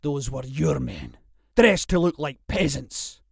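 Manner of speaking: mounting anger; angry accusation. Acted. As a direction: press the accusation harder as you go. Jack accuses Percy, building anger.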